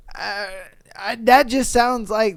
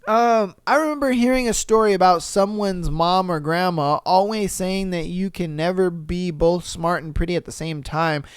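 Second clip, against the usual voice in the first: ordinary talk, nothing marked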